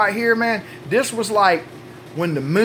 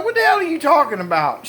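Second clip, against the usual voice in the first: high-pitched mock incredulity; feigned bewilderment; 8 semitones higher than usual. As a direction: play clueless, pitched high. Husband feigns ignorance, acted quarrel.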